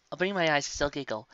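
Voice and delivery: usual speaking voice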